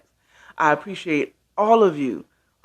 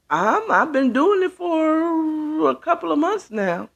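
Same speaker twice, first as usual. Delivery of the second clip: high answer; 11 semitones higher than usual. Real, not acted. Answering a viewer's question.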